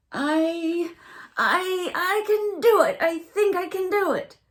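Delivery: very high, loud, straining little critter; little raccoon voice. A narrator's reading. Little raccoon cheering himself up on the log, high and straining. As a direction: squeak it out like a determined baby raccoon. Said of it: "said the raccoon".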